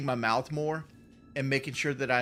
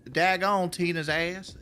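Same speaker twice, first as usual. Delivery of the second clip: slow, higher-pitched mock Southern drawl; long, lazy Southern vowels, pitched up; drawn-out Southern drawl; 4 semitones higher, slower than usual. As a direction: imitate a thick Southern accent, stretching every vowel. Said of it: "it's drawn out like".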